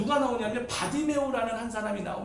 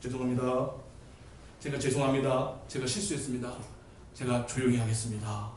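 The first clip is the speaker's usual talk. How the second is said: low, subdued mock meekness; cowed apology; 9 semitones lower, 6 dB quieter than usual. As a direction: apologise as if shrinking back. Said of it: "주눅 드는 거예요". (주눅 든 듯 나직한 사과)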